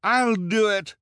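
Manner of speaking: loud, very high eager call; high-pitched henchwoman's cry. A narrator's reading. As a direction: pipe up loudly to volunteer. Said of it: "cried the other one".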